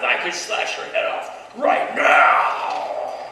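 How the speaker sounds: loud, drawn-out warrior's shout; enraged yell, building to a huge finish; furious threat. Acted. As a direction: roar it, stretching the last words out. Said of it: "angry, his red blood boiling".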